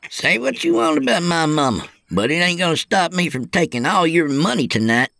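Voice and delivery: high-pitched put-on youngster voice; defiant young trainee. Trainee vowing to beat older truckers, defiant. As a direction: answer the teasing with high-voiced bravado. Voices Kyle hitting back.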